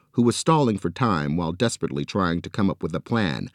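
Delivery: ordinary delivery, nothing marked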